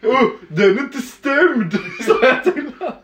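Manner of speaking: can barely get it out for laughing. Real, not acted. Laughing while retelling drunk moment.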